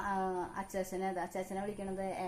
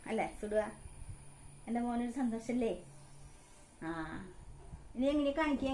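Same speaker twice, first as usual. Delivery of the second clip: put-on baby talk; 3 semitones higher than usual. Mother talking to her baby. (കുഞ്ഞിനോട് കൊഞ്ചി സംസാരിക്കുന്നു)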